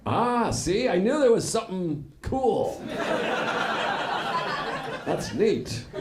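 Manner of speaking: ordinary talk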